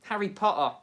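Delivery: ordinary talk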